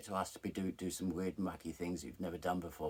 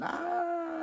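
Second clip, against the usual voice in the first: very high, loud comic squeal; mock shriek; 6 dB louder than usual. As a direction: let out a long hammed-up shriek to a friend. Phoning friend after shocking scene.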